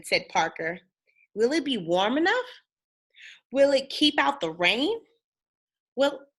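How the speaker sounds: put-on lively read-aloud for kids, bouncy tune; storybook reading. A narrator's reading. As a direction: read it like bedtime story time.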